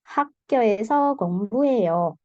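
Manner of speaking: 공부해요 falling at the end; statement intonation. Shown as a demonstration. Teacher demonstrating an answer to students, falling intonation on 공부해요. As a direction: answer plainly, dropping the pitch on 공부해요. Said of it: "jawaban itu nadanya turun".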